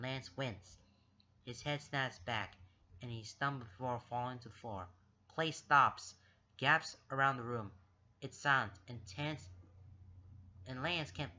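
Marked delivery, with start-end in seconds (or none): none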